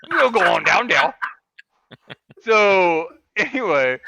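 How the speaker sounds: loud hammed-up Cajun announcement; Cajun accent. Host reading the community games notice in a Cajun accent, as asked. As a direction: read the notice like a bayou good old boy. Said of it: "Can you say that in a Cajun accent".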